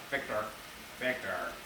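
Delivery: hint of a nagging mom; mom calling. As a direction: imitate a mother calling her son. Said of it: "Victor by my mom".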